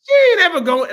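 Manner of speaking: high, loud laughing disbelief; laughing denial. Real, not acted. Scoffing at the idea of Julia dancing on TV, laughing denial. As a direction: burst out laughing as you say it.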